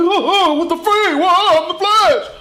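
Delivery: high, drawn-out mock freak-out; incredulous outburst. Hero whose mom never heard of Flash, incredulous. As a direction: cry it out high, stretching the last words.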